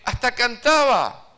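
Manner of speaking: high, loud, slow mock boast about singing; mock proud. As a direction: puff up: and I even sang!. Boasting he even sang.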